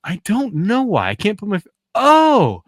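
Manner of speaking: high, dreamy Carl voice slowing at the end; giddy Carl. Retold or imitated. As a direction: float it up, blissfully unstressed. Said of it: "And he goes".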